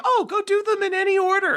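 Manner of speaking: high sing-song mock of the game's cheery advice. Streamer mocking the game's instructions to chat, high and sarcastic. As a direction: parrot it back with exaggerated breezy sarcasm. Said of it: "but they're like".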